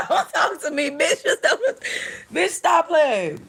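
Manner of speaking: high laughing protest; giggling don't talk to me. Real, not acted. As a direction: push the words out through real laughter. Laughing at the video.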